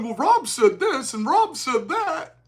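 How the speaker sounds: slow, lightly voiced whiny mimic; mocking opponents. Mimicking debate opponents fixated on him, slow mockery. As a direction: parody their fixation, drawn out. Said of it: "They were so focused on".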